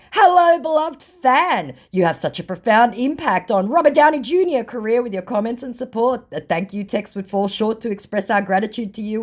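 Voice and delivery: high, sugary fake-fan-manager voice; reading a scammer's gushing message in a mock high voice. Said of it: "Now I want you to meet Alexander Carter".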